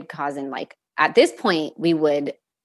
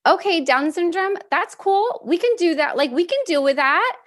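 much higher, upbeat and easygoing; breezy relief; 12 semitones higher than usual. Retold or imitated. Mom recalling her reply to the doctor, upbeat and breezy. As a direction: shrug it off cheerfully, like it's no big deal.

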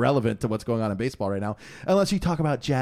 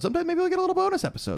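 very high, quick squeak; playful falsetto, rattled off fast; silly high voice; faster than usual. Pretend. Host teasing a little bonus episode, silly high voice.

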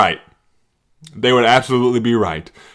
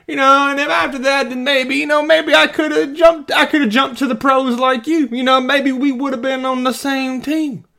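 high, strained wannabe-athlete voice; boastful ex-high-school player; 12 semitones higher than usual. Acted. Former high school player bragging to a pro, mock wannabe voice. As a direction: play up the wistful bragging, pitched way up. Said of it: "That's what I hear. People who played".

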